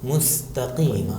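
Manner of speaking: مستقیم drawn out slowly with a thin sin; thin letter sin. Shown as a demonstration. Teacher giving an example of sin, slow thin مستقیم. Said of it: "پتلا ادا کیا جاتا ہے".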